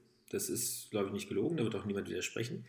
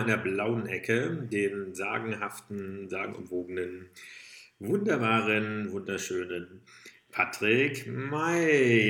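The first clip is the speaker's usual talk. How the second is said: drawn-out, loud fight-night introduction; boxing ring announcer; 5 dB louder, slower than usual. Pretend. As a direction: stretch the name like a ring announcer. Introduces co-host in blue corner.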